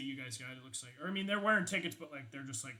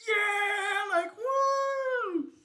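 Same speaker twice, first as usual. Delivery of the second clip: high, loud whooping cheer; crowd cheer; 11 dB louder, much slower than usual. Acted. Concert crowd whooping for the emcee, high and loud. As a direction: cheer like a rock fan.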